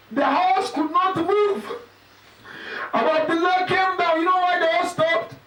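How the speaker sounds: mournful recitation. Acted. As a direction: lift the voice high into a lament. Laments the horse stopping.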